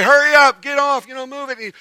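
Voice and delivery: high-pitched yell, fading at the end; imitated yelling. Preacher as kids yelling at child in the way, shouting. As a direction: badger them to get moving. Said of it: "everyone else is yelling at you".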